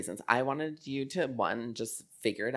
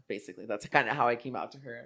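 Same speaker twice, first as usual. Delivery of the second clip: ordinary delivery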